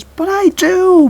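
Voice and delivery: very high, loud and drawn out slowly; desperate cry. Acted. Daughter cries out to Max as he runs, high and loud. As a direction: wail it after him as he runs off.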